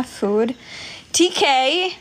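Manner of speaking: ordinary delivery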